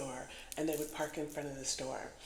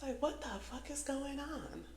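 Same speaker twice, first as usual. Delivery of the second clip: baffled; 8 semitones higher, 5 dB quieter than usual. Retold or imitated. Quotes own baffled reaction.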